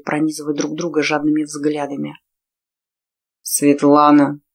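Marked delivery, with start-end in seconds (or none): 3.5-4.4 husky, breathless name; hoarse. A narrator's reading. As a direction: rasp the name with desire. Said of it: "прохрипел мужчина".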